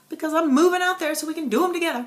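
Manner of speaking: fast, high and loud playful gush; gushing joke. Retold or imitated. Vlogger retelling her joke to Jen, fast giddy gush. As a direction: rattle it off in giddy excitement.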